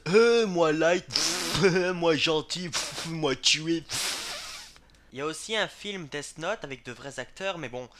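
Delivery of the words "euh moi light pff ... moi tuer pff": slow, loud, dim-witted grunting; dumb caveman voice. Retold or imitated. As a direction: play a brainless brute, slowly. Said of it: "j'avais peur que Death Note soit en fait".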